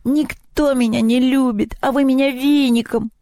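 high-pitched weepy goblin voice; hammed-up crying complaint; tearful lament. A narrator's reading. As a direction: wail pitifully, feeling unloved. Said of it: "заплакал кабинетный".

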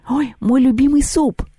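high, slow delighted cry; delighted squeal. A narrator's reading. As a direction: gush over the soup. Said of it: "восхищённые вопли".

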